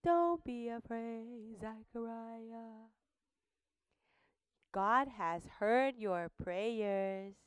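soft, slow comforting voice; reassuring angel. Acted. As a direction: soothe the frightened priest gently. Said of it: "Let's say".

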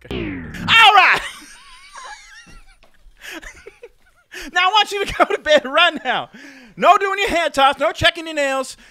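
put-on a bossy, high [name] telling the kid to go to bed; [name] impression. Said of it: "[name] impersonating [name]".

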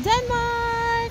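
high, loud, thrilled Denmark; excited exclamation. Real, not acted. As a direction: announce it with delight. Excited arrival announcement.

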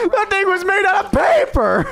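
high, loud, drawn-out disbelief; amazed shout. Real, not acted. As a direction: yell it out, stunned by the flimsy plane. Crop duster plane made of paper.

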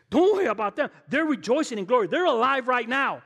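loud, high, fervent preaching at full force. Acted. Pastor rallying the congregation about martyrs, in full-force preaching. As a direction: preach it out, rising up to the rafters.

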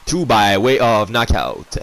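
loud, punchy ring introduction; fighting game announcer. Acted. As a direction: hype the crowd like a boxing MC.

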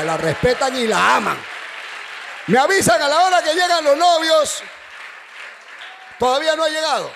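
loud preaching voice, pushing hard; full-force preaching. Acted. Preacher exhorting the congregation at full force. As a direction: proclaim it to the church.

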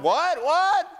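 bewildered double take; stunned disbelief. Retold or imitated. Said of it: "they're like".

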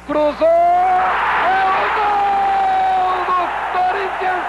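long drawn-out goal shout, high. Acted. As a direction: yell the goal slowly.

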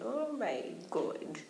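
ordinary talk

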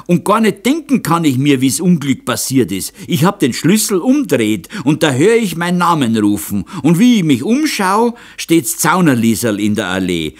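high, loud protest in dialect; agitated old man swearing innocence; gasping old man. A narrator's reading. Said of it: "keuchte der Alte". (aufgeregter Alter, hoch und laut im Dialekt)

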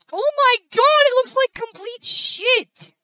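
high, loud outburst, slowing at the end; exasperated, stretching the final curse; real exasperation. Real, not acted. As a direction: cry out in furious disbelief. Recorded footage turns out ruined.

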